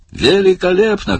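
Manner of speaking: high, ringing director's cry; delighted shout. A narrator's reading. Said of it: "крикнул Барни". (восторженный крик, высоко)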